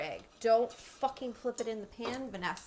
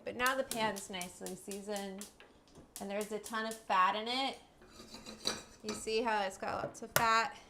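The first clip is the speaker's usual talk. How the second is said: normal talking voice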